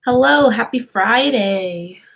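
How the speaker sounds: ordinary talk, nothing marked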